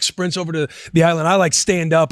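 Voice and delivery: ordinary talk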